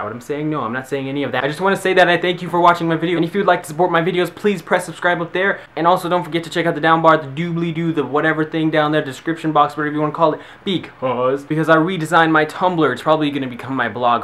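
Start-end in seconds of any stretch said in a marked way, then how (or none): 1.4-12.9 outro announcer. Acted. Energetic outro pitch.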